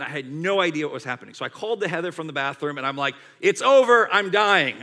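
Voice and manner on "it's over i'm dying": loud, high melodramatic wail; hammed-up retold cry of agony; screaming in pain. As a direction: cry out as if dying, playing it big. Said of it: "screaming and crying out".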